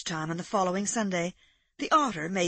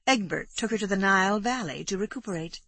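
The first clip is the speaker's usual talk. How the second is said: ordinary delivery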